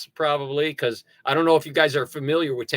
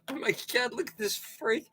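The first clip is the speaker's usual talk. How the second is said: mock viewer gasp; 8 semitones higher, 8 dB quieter than usual. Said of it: "I'm hoping all you get a little".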